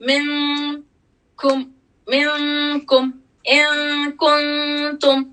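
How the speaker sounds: slow मिनकुम with the noon hidden in a nasal hum; nasal ikhfa sound. Shown as a demonstration. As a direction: stretch मिनकुम so the noon hums through the nose, tongue not touching. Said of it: "ये nasal sound हो रहा है".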